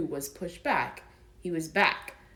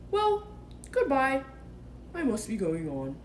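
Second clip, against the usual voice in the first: Pooh voice; 6 semitones higher than usual. Acted. Pooh's voice, high.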